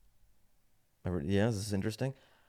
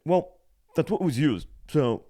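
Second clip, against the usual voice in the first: high, louder put-on clerk voice; insisting clerk; 7 semitones higher, 6 dB louder than usual. Clerk insisting on refund card, mock clerk. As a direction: mimic a clerk who will not budge.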